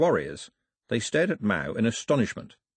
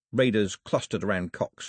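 ordinary delivery, nothing marked

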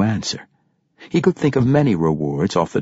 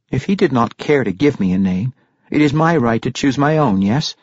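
mildly assertive character voice, pitched up; lightly voiced Regis; 8 semitones higher, 4 dB louder than usual. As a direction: make a case for yourself, a touch higher. Narrator voices Regis arguing.

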